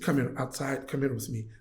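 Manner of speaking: put-on a rough Saudi accent, voice dropped; horrible foreign accent. Bank worker quietly inviting him outside, horrible accent. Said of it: "I hope I'm my horrible accent".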